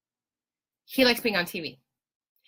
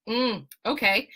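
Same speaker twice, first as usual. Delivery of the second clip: ordinary talk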